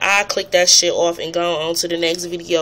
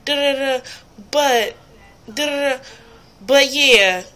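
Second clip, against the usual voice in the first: mock stammering vlogger; 5 semitones higher than usual. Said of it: "I see videos where people is like".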